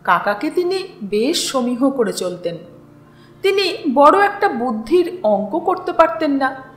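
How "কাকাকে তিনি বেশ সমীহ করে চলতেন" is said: usual speaking voice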